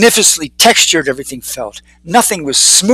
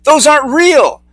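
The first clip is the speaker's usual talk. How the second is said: high-pitched warning, stumbling over the words; urgent hollering; 10 semitones higher, 4 dB louder than usual. A narrator's reading. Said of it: "Eugene hollered back".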